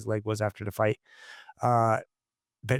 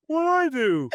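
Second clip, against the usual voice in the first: much higher, louder, wide-eyed innocent squeak; innocent fighter; 3 dB louder, slower than usual. Retold or imitated. Stopped fighter answering his angry coach, mock innocent high voice. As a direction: play the clueless kid who did nothing wrong. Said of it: "he goes".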